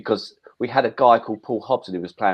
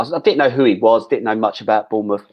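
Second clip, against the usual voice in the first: normal talking voice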